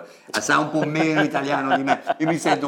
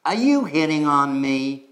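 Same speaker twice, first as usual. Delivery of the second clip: slower, higher mock-indignant American woman; 4 semitones higher, 5 dB louder than usual. Teacher as an American woman being chatted up, mock surprise. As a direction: play it coy and teasing. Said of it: "I'm gonna be an American woman".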